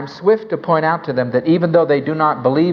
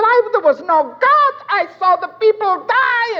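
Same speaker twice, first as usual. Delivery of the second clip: high anguished cry in her voice; retold scream. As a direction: wail out what she saw. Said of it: "And she said".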